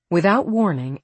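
usual speaking voice

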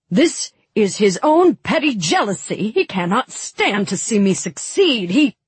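high-pitched, indignant protest; agitated outburst. Acted. Vasily accusing his brother before the guests, agitated and high. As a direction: rant with the voice riding high and swooping. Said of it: "his lip trembling".

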